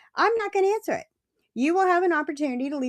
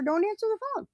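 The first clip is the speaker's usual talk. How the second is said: stern advice to mother-in-law; 10 semitones higher, faster than usual. Retold or imitated. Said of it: "I had to tell her".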